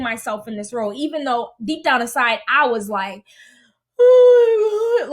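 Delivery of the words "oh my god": high, loud, stretched inner wail; mock losing it. Inner panic while forcing herself to help, mock wail. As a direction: wail it out as if cracking up. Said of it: "like, losing my mind".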